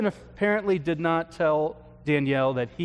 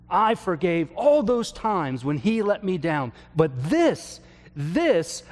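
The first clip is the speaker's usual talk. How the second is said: raised, slowed, half-acted outburst of anger; retold angry yell; 4 semitones higher, 3 dB louder than usual. A grieving widow yells at her pastor, mock angry outburst. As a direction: relive her furious cry, pitched up and louder. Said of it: "finally she just yelled out in my office".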